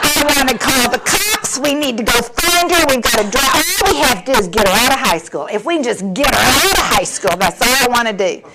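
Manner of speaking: mock-panicked, insistent outburst; frantic ranting. Mother desperate to find missing daughter, frantic. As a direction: rant as if nearly out of your mind.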